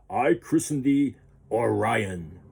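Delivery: slow, stately royal blessing; lightly voiced king, formal and slow; king's proclamation. A narrator's reading. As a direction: christen the ship like a proud king. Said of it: "the king said".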